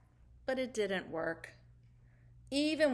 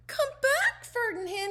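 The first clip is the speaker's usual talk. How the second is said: much higher, louder calling shout; 12 semitones higher, 4 dB louder than usual. Acted. Caterpillar calling the fleeing fish back, shouting. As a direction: call after him desperately. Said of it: "he shouted".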